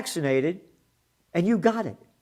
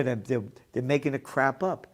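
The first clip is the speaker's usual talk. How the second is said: usual speaking voice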